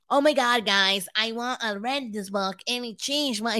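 normal talking voice

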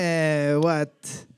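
mock French-Canadian accent. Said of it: "I'm not good at a French accent".

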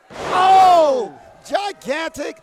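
yelling the big move; roaring with excitement; excited shout. Real, not acted. Big move in live commentary.

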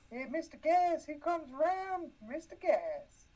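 high put-on voice, last words slowed; imitating coworkers. Retelling coworkers greeting Mister Gas in a high put-on voice. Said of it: "I don't know why I sounded like one".

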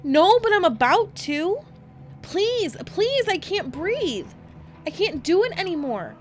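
high, loud panicked pleading; Candace screaming. Retold or imitated. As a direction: beg in panic as if you're suffocating. Retold child pleads under pillows.

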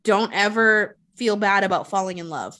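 ordinary talk

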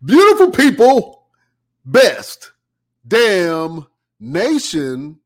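loud drawn-out hype opener; hype announcer intro. Acted. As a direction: belt it like a showman. Podcast host hype intro.

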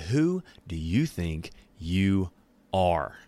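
stern rebuke. Retold or imitated. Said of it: "He said".

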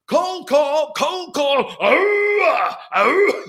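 high, loud panicked alarm cry; mock alarm cry. Prospect hearing a cold call, panicked high alarm cry. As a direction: sound the alarm like a startled prospect. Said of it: "what the person on the other end is hearing is".

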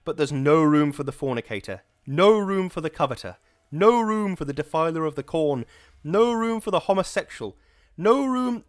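loud, high, hammering rhythm that keeps climbing; powerful pumping cadence. Acted. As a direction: drive each no room like a rock beat. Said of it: "a powerful, pumping cadence".

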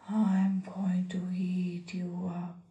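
low, menacing troll whisper. Acted. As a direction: breathe it like a hungry troll. Troll threatens.

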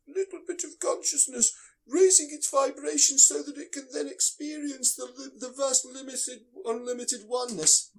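mock them in a high voice. Mocks vibration raisers.

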